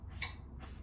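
hissed "S, S, S" unvoiced like a snake; s sound. Shown as a demonstration. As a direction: stretch each "S" into a hiss. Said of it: "S is for sun".

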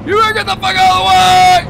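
furious shout to clear the road; angry shout. Real, not acted. Yelling at the drivers blocking his car, furious shout. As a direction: yell it high at the car ahead.

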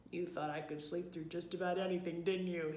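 lightly voiced booming bear; hearty big-bear voice; a touch of a booming husband bear. A narrator's reading. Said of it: "he boomed".

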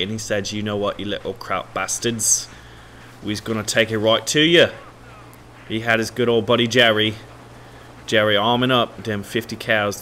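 hammed-up British storyteller accent; terrible British accent. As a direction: narrate a war tale in a badly faked British accent. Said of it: "that's just a terrible accent".

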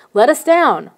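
a frightened cry, held short of a full scream. A narrator's reading. Kid carried off on his back by gnomes, mild scream. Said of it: "I screamed".

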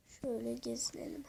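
soft unvoiced whisper. Real, not acted. Gamer touring his Minecraft village for viewers, whispering. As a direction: breathe the words, no voice at all.

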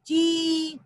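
ची with a drawn-out ee; long vowel. Shown as a demonstration. Teacher showing what चा will become, long-held ची. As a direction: hold the vowel in ची. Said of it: "बड़ी की मात्रा जोड़ेंगे".

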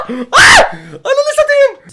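high, loud laughing yell of disbelief; shouting laugh. Real, not acted. Gamer pulls Oliver Kahn out of nowhere, shouting laugh.

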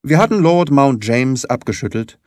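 usual speaking voice

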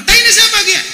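high, loud indignant demand; indignant shout. Retold or imitated. Ngapak man indignantly shouting at the Sundanese villager. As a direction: yell it like you refuse to accept. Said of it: "Lah orang Ngapak nggak terima".

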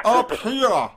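high, loud call; echoing the awkward taunt; loud mock taunt. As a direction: bellow it up at him. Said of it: "echoing the movie line".

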